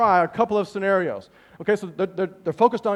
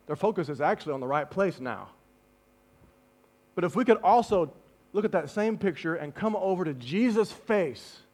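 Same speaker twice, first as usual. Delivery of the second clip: ordinary talk, nothing marked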